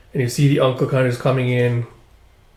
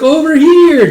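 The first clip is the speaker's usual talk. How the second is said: high excited shout; calling out; 10 dB louder, slower than usual. Acted. Little Yoko shouting to the planes, high and loud. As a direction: yell it out to get attention. Girl calls to passing planes.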